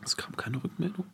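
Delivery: breathy whispered echo. Real, not acted. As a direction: whisper it under your breath. Echoing partner's question flatly. (geflüstert)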